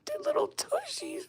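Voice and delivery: very high, squeaky gushing; cuteness overload squeal. Real, not acted. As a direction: squeal as if about to cry from cuteness. Overwhelmed by cuteness.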